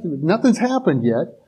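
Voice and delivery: ordinary talk, nothing marked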